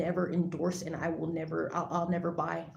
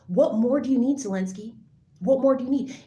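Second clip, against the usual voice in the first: angry demand; 5 semitones higher, 6 dB louder than usual. Real, not acted. Angry rant at Zelensky.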